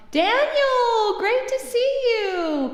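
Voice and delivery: high, drawn-out delighted welcome; excited greeting. Real, not acted. Streamer greeting a viewer who just joined, excited. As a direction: sing out a big hello, high and stretched.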